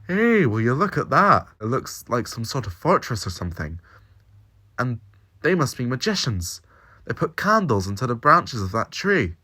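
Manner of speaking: put-on eager young Scot. A narrator's reading. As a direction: Jamie gawping at the fortress, amazed.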